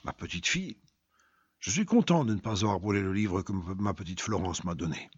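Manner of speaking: low, soft and tender; calmer, tender. Acted. Softened father speaks of his daughter, quiet tender. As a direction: speak gently of your little girl. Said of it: "Il était devenu plus calme".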